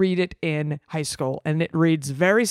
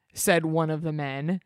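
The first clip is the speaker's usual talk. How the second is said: normal talking voice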